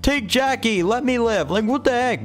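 high mock plea of a brother; exaggerated cowardly-brother imitation. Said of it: "You're literally like".

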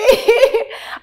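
loud high-pitched real laugh. Real, not acted. Guest amused at describing herself as hyper, high laugh.

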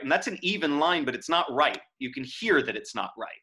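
ordinary talk, nothing marked

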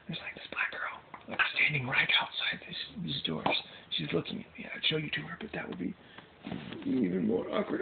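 real hushed whisper; secretive whisper to camera. Real, not acted. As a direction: whisper so she can't hear. Being watched, hiding.

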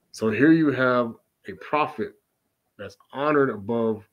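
ordinary talk

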